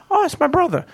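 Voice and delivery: put-on high, soft, casual girlfriend reply; light girlfriend voice. Girlfriend casually explains [name] is her brother, high and soft. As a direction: play it offhand, like it's nothing. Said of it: "she's like".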